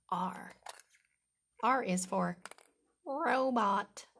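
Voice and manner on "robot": high, loud announcement; excited reveal. Acted. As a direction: call out the word proudly.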